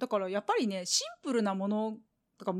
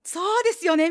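eager agreement; 6 semitones higher, 5 dB louder than usual. Retold or imitated. Agreeing with tired older man.

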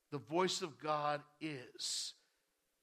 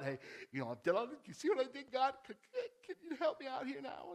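quick, higher, laughing parody of a pleading believer; mock needy prayer; 7 semitones higher, faster than usual. Believer begging God for notice, mock pleading. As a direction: poke fun at bargaining with God.

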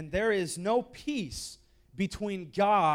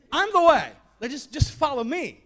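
loud, higher, insistent; emphatic Jesus; 8 semitones higher, 10 dB louder than usual. Retold or imitated. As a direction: plead him to just follow. Jesus insisting, voiced loudly.

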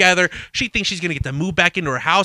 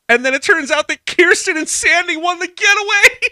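laughing; 12 semitones higher, 4 dB louder than usual. Real, not acted. Laughing at the plot twist.

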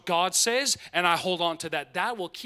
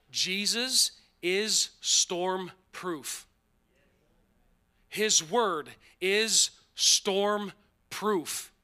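slow emphatic declaration; much slower than usual. Real, not acted. Preacher lands key point.

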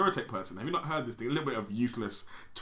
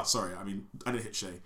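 ordinary talk, nothing marked

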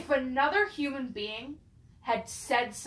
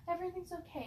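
put-on higher, softer voice of a gentle old lady; sweet old lady; 6 semitones higher, 9 dB quieter, much faster than usual. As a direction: soothe like a grandma knitting in her rocker. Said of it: "sweet, like, old lady".

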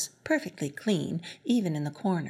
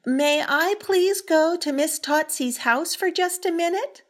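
polite call upstairs; 7 semitones higher, 4 dB louder than usual. Acted. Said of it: "Matilda tried again".

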